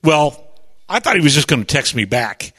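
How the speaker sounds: ordinary delivery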